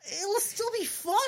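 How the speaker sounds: high, squeaky cheerfulness; beaming. Acted. Blueberry stays upbeat after being corrected, beaming. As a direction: beam through the words, very high. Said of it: "he beams".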